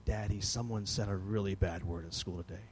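put-on low, hushed child's report; confiding child. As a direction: softly tell Dad a shocking secret. Child confides bad word to dad.

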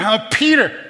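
high, excited call to his brother; excited scream. Acted. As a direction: shout it out high, imitating wild excitement. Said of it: "I mean, he's screaming".

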